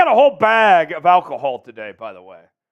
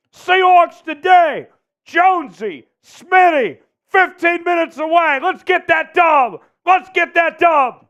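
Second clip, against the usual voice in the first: rally the crowd; hype shouting; 8 semitones higher than usual. Real, not acted. Pre-game hype chant.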